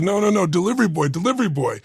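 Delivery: imitated high, insistent objection; flustered protest. Delivery boy protesting to a woman kissing him, flustered. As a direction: raise the pitch to protest and correct. Acted protest at being kissed.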